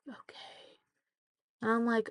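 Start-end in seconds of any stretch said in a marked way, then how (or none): 0.1-0.8 whispered sulky okay; whispered pouty okay. Retold or imitated. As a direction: breathe it out, pouting.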